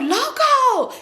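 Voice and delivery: high, excited call of a name; overjoyed greeting. Retold or imitated. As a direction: sing out the name in delight. Retold delighted greeting of Loco.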